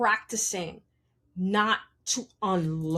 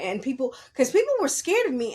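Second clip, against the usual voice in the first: ordinary talk, nothing marked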